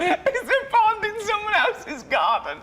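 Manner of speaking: high-pitched laugh through the words. Real, not acted. Laughing at his mate's muddled saying. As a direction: crack up while repeating the line.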